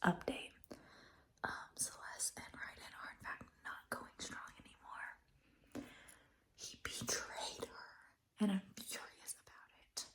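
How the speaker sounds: hushed, breathy whisper with no voice; whispered update. Real, not acted. Late-night reading update to viewers, whispered. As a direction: whisper it so nobody wakes up.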